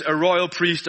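normal talking voice

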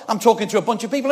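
ordinary talk

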